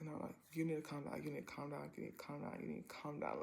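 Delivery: low, hushed self-talk on a loop; urgent self-soothing. Retold or imitated. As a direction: talk yourself down under your breath. Retold talking oneself down.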